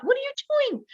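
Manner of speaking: sharp high disbelieving self-question; alarmed self-questioning. Retold or imitated. Man in the car scolding himself, high alarmed. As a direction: jolt up in pitch at his own move.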